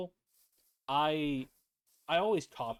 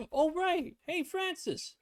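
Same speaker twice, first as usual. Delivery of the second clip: high villager voice; slower than usual. Pretend. Greeting villager in game voice.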